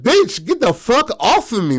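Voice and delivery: high-pitched acted outrage; angry. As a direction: act out furiously shaking someone off. Woman fighting off the one grabbing.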